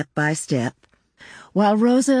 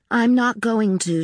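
put-on higher-pitched, mildly offhand child's refusal; mild, higher-pitched indifference from a child; indifferent; 7 semitones higher than usual. A narrator's reading. Zita to Paul about leaving, mildly indifferent. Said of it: "she replied with indifference".

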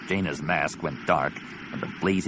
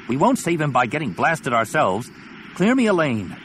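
higher and louder, demanding; urgent shout; 7 semitones higher, 4 dB louder than usual. Acted. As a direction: bark out the order. Arguing in battle.

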